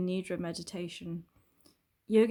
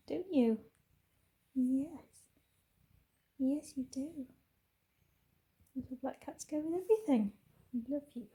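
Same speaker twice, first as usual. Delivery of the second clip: high sing-song cooing to a cat; pet talk; 6 semitones higher than usual. Real, not acted. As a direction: coo lovingly at your cat, pitched up. Talking fondly to her cat.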